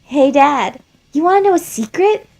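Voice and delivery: put-on high, loud childlike flower voice; high eager child voice, pushed loud; Flowey, gleeful child. Flowey speaks to his father.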